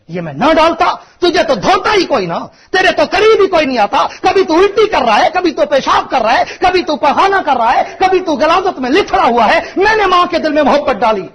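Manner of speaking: climbing high and loud, full pulpit force; impassioned preaching. Real, not acted. Preacher booming God's words to the congregation, at full force. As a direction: preach it out to the whole hall, rising to a cry.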